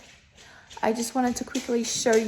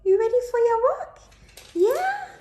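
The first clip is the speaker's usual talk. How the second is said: much higher, louder rising squeal; excited pet squeal; 6 dB louder than usual. Real, not acted. Squeals to the puppy to get her hyped for the walk. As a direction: whip the dog up with a squeal.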